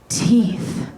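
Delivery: slow eerie whisper; creepy whisper. Retold or imitated. Toddler whispering a joke close to her face, eerie whisper. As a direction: breathe the word like a creepy child. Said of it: "he whispered".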